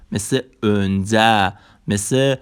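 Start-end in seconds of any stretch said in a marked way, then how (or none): 0.6-1.5 "انزه" slow, as önce with rounded ö; Turkish ö demo. Shown as a demonstration. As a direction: stretch "انزه" as ön-je.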